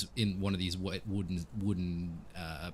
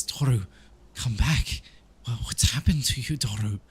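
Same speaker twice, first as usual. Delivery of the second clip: higher, louder plea to someone changed; pleading woman character; 4 semitones higher, 6 dB louder than usual. Acted. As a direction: call out to him, urgently. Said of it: "and she says".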